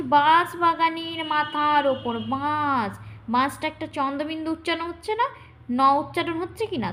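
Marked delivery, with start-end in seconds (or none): 0.0-3.0 slow chanted reading, long vowels; drawn-out verse reading. Shown as a demonstration. Teacher demonstrating the stressed beat in verse, slow drawn-out reading. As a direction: stretch each beat of the verse. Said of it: "ধরো আমি পড়ছি".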